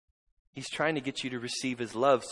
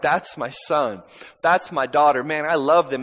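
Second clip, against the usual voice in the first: proud loving Father; 2 semitones higher, 11 dB louder than usual. Acted.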